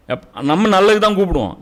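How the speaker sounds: ordinary talk, nothing marked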